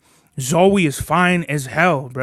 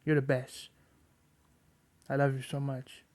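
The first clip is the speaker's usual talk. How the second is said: tender praise; 11 semitones higher, 9 dB quieter than usual. Real, not acted. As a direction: murmur love to the puppy. Praising his dog softly.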